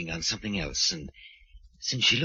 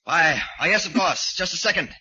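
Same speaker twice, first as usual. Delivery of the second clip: loud, raised-pitch stammered reply; flustered calling out; 8 semitones higher, 6 dB louder than usual. Acted. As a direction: call hastily through the door, caught off guard. Flustered, calling through door to police.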